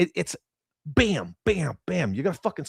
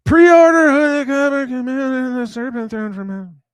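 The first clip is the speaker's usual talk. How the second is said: much higher and louder mock yell; mock screaming; 11 semitones higher, 9 dB louder than usual. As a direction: shout the pre-order pitch like a hyped fan. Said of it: "is fucking screaming at me".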